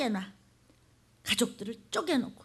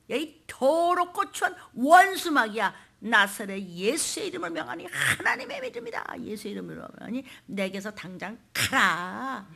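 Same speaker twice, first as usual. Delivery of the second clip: commanding exorcism rebuke; 4 dB louder than usual. Acted. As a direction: drive the devil out with authority. Acts out casting out Satan. (마귀를 꾸짖어 쫓는 호령)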